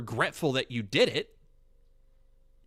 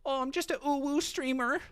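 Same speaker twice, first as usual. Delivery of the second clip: higher, cutesy feigned innocence; mock innocent streamer; 8 semitones higher than usual. Mocking a streamer feigning innocence, cutesy higher voice. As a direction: act like a harmless little guy. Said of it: "double back and be like".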